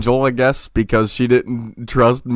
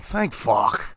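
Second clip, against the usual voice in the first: relieved mutter; 9 dB quieter than usual. Retold or imitated. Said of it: "I'm like".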